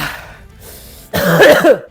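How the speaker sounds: loud real cough. Real, not acted. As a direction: cough out the burn. Coughing after drinking vodka.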